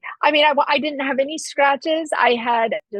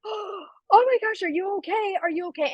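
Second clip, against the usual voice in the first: worried gasp; 9 semitones higher, 4 dB quieter than usual. Retold or imitated. Car owner checking on the kid, soft and high worried. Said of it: "And they were like".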